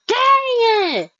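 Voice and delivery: high-pitched, loud rant; explode, keeping it high to the end; frustrated outburst. Real, not acted. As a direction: wail in exasperated fan dismay. Rants about a lost ship.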